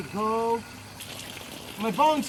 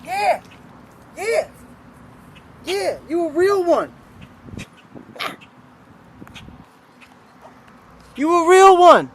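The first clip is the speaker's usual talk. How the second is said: praising the bird; 7 semitones higher, 4 dB louder than usual. Real, not acted.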